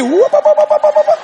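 high, loud repeated syllables; exasperated mimicry of babble; imitated whooping. Preacher begs woman for different syllable, high mock whooping. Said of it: "get a different syllable here".

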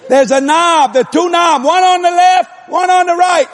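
high holler through a floor. Retold or imitated. Husband under the house directs wife upstairs, hollering. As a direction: holler instructions to someone upstairs. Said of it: "I'm hollering this through the floor".

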